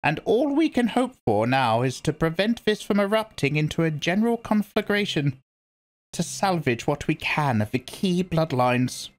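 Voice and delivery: put-on grave old priestess speaking; stern old Reverend Mother. A narrator's reading. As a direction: lecture her like a severe elder. Reverend Mother warns Jessica.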